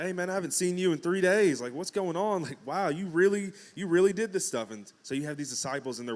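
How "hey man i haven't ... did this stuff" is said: higher and louder, amazed disciple; excited greeting. Retold or imitated. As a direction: greet an old friend back from the dead. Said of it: "It's like".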